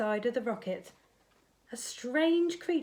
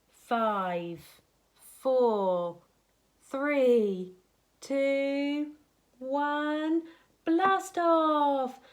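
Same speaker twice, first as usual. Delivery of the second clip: countdown building up; 4 semitones higher, 5 dB louder, much slower than usual. A narrator's reading. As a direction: start steady and climb higher and louder to a shouted blast off. Rocket countdown to launch.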